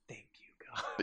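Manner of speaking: whispered thanks to heaven; hushed swooning thank you; breathy whisper. Retold or imitated. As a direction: whisper it like a prayer answered. Samantha swooning over hottie.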